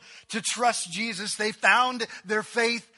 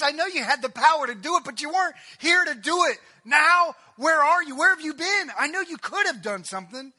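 high, loud acted accusation; angry reproach; 8 semitones higher, 6 dB louder than usual. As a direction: hurl questions at him, voice raised. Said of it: "and I'm just mad".

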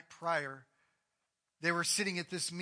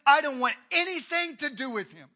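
loud and higher, insistent; adamant denial; 7 semitones higher, 10 dB louder than usual. Acted. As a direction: refuse him outright. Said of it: "adamantly saying".

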